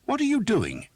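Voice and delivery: very high, loud demand; furious demand. Acted. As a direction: confront the knight head on. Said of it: "the priest demanded".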